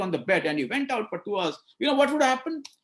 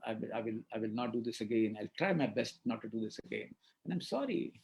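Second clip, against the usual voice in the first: soft, lower, heartfelt sorry; sincere apology; 7 semitones lower, 7 dB quieter than usual. Retold or imitated. Doctor apologising softly to angry waiting patients. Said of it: "I said".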